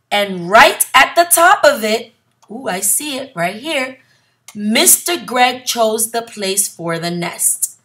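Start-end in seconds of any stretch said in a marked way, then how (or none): none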